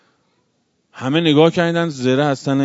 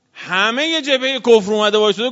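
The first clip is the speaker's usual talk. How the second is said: raised high and loud, full sermon force; preaching shout; 9 semitones higher, 6 dB louder than usual. Acted. Preacher crying out to mourners about the enemy, loud and high. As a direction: cry it out to the congregation.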